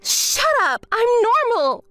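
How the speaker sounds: angry. Acted. Denying instability, shouting.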